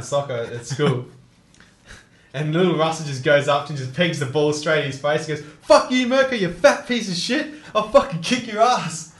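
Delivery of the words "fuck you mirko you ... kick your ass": high furious schoolboy rant, spitting every curse; retold furious outburst; angry yell. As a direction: scream it at the bully who mocked you. Russell yelling at bully.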